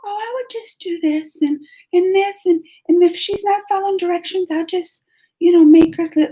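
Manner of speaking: high, sugary fake-nice teacher voice; a mock syrupy teacher. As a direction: mimic the teacher's put-on sweetness. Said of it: "she just".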